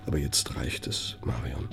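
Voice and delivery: soft, threatening whisper; menacing whisper. Acted. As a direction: warn her quietly, close to her ear. Boss threatens secretary privately. (drohend geflüstert)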